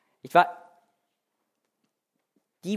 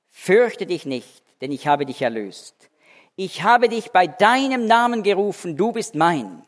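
scripture reading; 3 semitones higher, 4 dB louder than usual. Acted. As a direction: proclaim the verse to the congregation.

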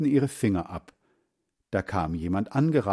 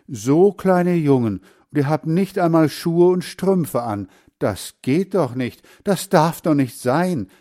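put-on old woman's concerned outcry, higher and louder; dismayed exclamation; 6 semitones higher, 6 dB louder than usual. A narrator's reading. Old woman dismayed at barefoot boys. (bestürzter Ausruf)

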